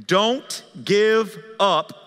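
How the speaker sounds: very slow, each word held; emphatic, spaced-out preaching; drawn-out exhortation. Real, not acted. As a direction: stretch every word as an urgent plea. Urging the congregation.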